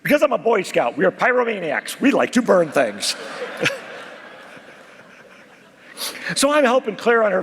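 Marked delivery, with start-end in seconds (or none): 3.2-4.1 high breathy laugh. Real, not acted. Laughs at own joke.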